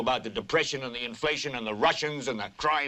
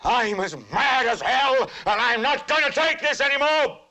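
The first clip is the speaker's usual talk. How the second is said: angry yell; 7 semitones higher than usual. Acted. Anchor showing viewers what to yell, outraged high yell. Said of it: "stick your head out and yell".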